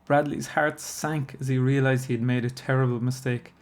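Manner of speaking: plain reading voice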